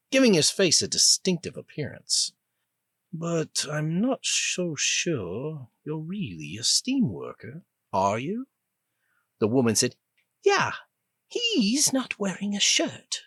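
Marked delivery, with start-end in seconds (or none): 3.1-8.5 put-on slow, measured British accent; a slow, musing British officer; the older British prisoner sizing up the worker; British older man. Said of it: "He likewise had an accent that seemed British". 10.4-13.2 put-on high, indignant British-accented scolding; furious British prisoner snapping at her clumsy rescuer; angry British woman. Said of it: "She had a clipped accent that sounded vaguely British".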